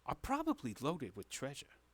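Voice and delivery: lightly voiced shady patron pitching a job; a hint of a wheeler-dealer; scheming tavern boss. Game master plays treasure-seeking boss.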